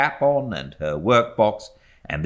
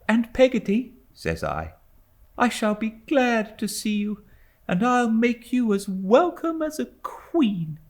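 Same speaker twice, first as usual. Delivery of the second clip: put-on much higher, earnest small boy; young boy David. A narrator's reading. Boy promising his nurse a welcome, high and earnest. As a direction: promise it like an eager child.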